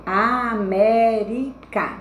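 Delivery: América separated slowly into a, mé, ri, ca; teacher's slow split of América; syllable by syllable. Shown as a demonstration. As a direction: draw out each syllable of América. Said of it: "eu vou contar as três últimas".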